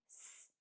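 bare, sustained S sound; speech sound S. Shown as a demonstration. Teacher gives pupil the first sound of a word, held S sound. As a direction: say "s" as a held hiss, not the letter name. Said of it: "Zaczyna się od głoski".

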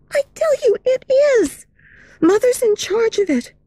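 put-on high, quick insistent girl. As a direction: insist eagerly. Meg insists.

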